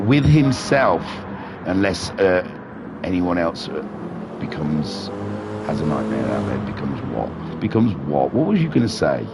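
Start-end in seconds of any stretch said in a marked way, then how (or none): none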